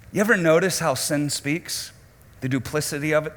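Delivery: usual speaking voice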